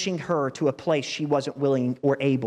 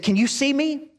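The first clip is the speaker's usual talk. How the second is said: high, louder call to the gymnast; coach calling out; 9 semitones higher, 3 dB louder than usual. Retold or imitated. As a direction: call up to her from the sidelines. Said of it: "He said".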